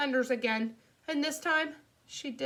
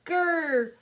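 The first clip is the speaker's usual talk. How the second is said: loud, high little growl; scary clown growl; 6 semitones higher, 6 dB louder than usual. Acted. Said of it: "I made a scary clown face".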